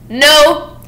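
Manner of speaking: sudden loud, high no; mock outraged landlady shout. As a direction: burst in and bark it. Landlady bursts in.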